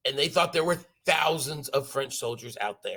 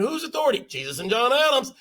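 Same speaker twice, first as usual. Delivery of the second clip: mock exchange; 7 semitones higher, 3 dB louder than usual. Quick replay of exchange.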